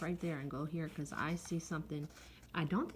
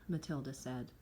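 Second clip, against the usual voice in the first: usual speaking voice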